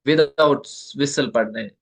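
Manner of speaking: without drawn out and stressed slowly; slow stressed "without whistle". Shown as a demonstration. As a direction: lean on "without" unhurried. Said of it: "इनको बिल्कुल".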